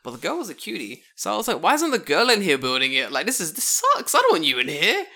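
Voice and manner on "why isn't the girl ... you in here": louder, higher whiny joking gripe; mock complaint. To his friend about the male worker, a louder mock whine. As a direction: grumble like a spoiled brat, half-joking.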